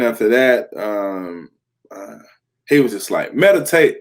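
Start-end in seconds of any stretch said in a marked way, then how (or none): 3.3-4.0 a firm, louder one-word command; curt guru order. Retold or imitated. Guru cutting the call short with one firm word. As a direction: snap the word Meditate out like a final order.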